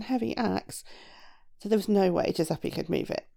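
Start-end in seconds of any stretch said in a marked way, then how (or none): none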